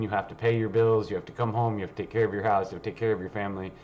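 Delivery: ordinary talk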